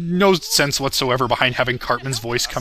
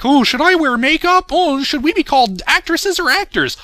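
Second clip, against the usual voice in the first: much higher, louder sneering mock voice; mocking fashion feminist; 12 semitones higher, 3 dB louder than usual. Creator ranting, parodying trivial feminist complaints in high mock voice. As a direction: falsetto parody of a fussy activist. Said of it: "not bullshit like".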